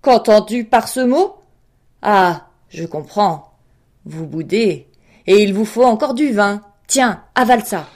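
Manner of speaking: bully him fiercely into drinking; tyran féroce. A narrator's reading. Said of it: "cria le tyran férocement". (roi furieux, éclats de voix)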